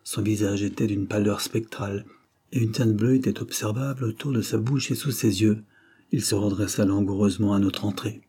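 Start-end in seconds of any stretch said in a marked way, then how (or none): none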